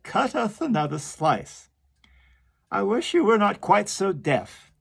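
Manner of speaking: carpenter. Acted. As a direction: snap at the deaf walrus. Carpenter grumbling at deaf walrus.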